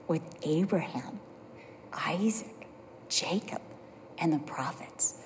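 hushed, breathless whisper of hope. Retold or imitated. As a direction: barely voice the names, like a secret. Retelling hushed words to the student.

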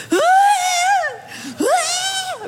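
high wailing cry. Retold or imitated. Wife wailing alone in the kitchen, high cries. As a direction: wail over the blender like it's the end. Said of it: "I'm in the kitchen going".

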